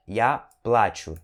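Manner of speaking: плачу as PLA-chu, A clearly stressed. Shown as a demonstration. Teacher recaps the correct form, stressed A in плачу. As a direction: punch the first syllable of плачу. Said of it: "Então o correto é".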